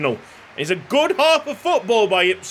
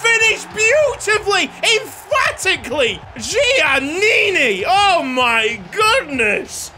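excited goal call; 11 semitones higher, slower than usual. Real, not acted. Player commentating Giannini's finish, excited goal call. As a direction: roar the finish then savour the name.

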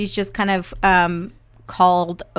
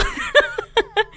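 giggle. Acted. Kid character giggling.

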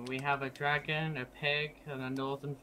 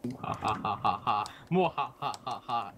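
evil laugh; 4 semitones lower, slower than usual. Acted. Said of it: "Evil anal".

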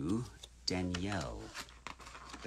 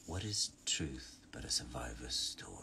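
low, whispery villain voice; villain role; 6 semitones lower, 4 dB quieter than usual. Acted. Villain quote for a signed print, low and hushed. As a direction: half-whisper it, pitched down. Silco quote written for a buyer.

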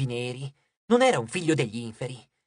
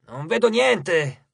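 slow, slightly raised grumble; grumbling; 4 semitones higher, 4 dB louder, much slower than usual. A narrator's reading. Hedge searching the horizon in vain, grumbling. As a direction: grumble it out, drawn out. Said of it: "bofonchiò Hedge".

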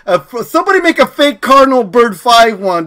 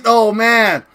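ordinary talk

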